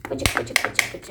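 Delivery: quick voiceless squirting sounds; low, repeated spritz noises; imitated spray noise. Shown as a demonstration. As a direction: mimic a spray bottle, all breath. Imitating spray bottle being squeezed.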